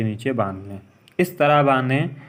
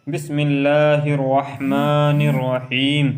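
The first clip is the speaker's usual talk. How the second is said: slow, drawn-out recitation; much slower than usual. Shown as a demonstration. Teacher reciting basmala for students, slow and drawn out. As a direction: draw out each word of the basmala.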